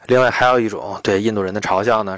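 ordinary talk, nothing marked

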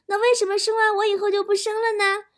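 put-on high, eager child's question; thrilled kid fishing for praise; excited, proud. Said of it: "我当时很兴奋啊，很骄傲地问我妈说".

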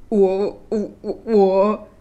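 stuttering, halting prince; stammering. A narrator's reading. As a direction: stumble over the first word, nervously repeating it. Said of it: "王子结结巴巴地说".

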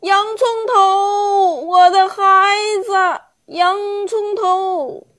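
high, drawn-out frantic calls; old onion calls desperately for his son as he is taken away; panicked calling. Acted. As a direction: call out for your son in panic. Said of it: "惊慌失措 一边叫道".

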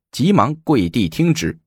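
ordinary delivery

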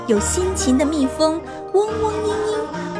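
usual speaking voice

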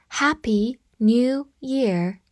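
slow, each word separated; slow read-through. Shown as a demonstration. Teacher showing learners a New Year greeting, slow read-through. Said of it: "好，我们慢读一遍".

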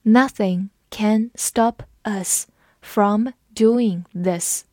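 slow, every word spaced out; slow classroom read-aloud. Shown as a demonstration. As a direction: read it slowly so learners can follow. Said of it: "我们慢读一遍".

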